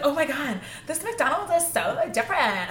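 high, mocking wide-eyed American teen; gushing tourist. Classmates marvelling at London McDonald's, mock gushing.

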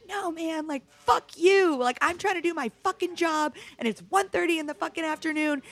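high, heated retold outburst; yelling back. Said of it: "I just, like, lost it".

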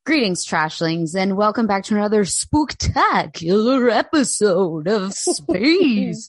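slow, eerie ghostly drawl; haunt the listeners with a ghostly greeting; spooky ghost voice. Acted. Spooky podcast intro greeting.

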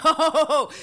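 high, loud laughing exclamation. Retold or imitated. Constantine laughing at Villanelle's plan. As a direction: burst out laughing on it. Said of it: "And he's, he's like".

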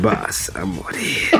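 low, slow seductive whisper-voice; incubus. Pretend. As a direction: purr it like a seducer. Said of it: "hablo con una voz sensual". (voz sensual grave y lenta)

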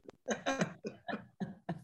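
breathy, voiceless laugh. Real, not acted. Amused at her own text to a friend, breathy laugh.

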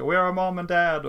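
mock scream. Lost kids looking for their parents, mock frightened cry. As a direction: imitate a worried kid calling out. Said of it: "De skriker".

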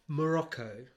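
ordinary talk, nothing marked

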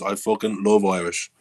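normal talking voice

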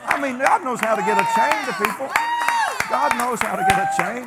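high, loud shout through laughter; laughing, shouted punchline to the congregation; exultant preaching. Real, not acted. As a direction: preach it out at full force, delighted. Preacher exulting at story's climax.

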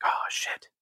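hushed, sinking realization; whispered dismay. Retold or imitated. Man retelling how Homer realizes the news, whispered. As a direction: whisper it as it dawns on him.

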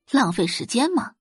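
ordinary delivery

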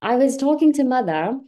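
loud, hammed-up British chat; playful mock British voice; fake British accent. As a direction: tell it as a put-on Brit. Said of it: "performing in this accent".

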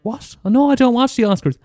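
high, startled squeak of a reply; Sharon caught out. Pretend. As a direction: play the friend caught unawares. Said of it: "She'll say".

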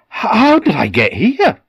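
high, loud confused outburst; startled awake and lost; bewildered alarm. Acted. Man wakes confused, no memory.

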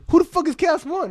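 high, loud puzzled demand; mock indignant. Old friend on the phone, loudly puzzled by the name. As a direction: bark the question at a stranger.

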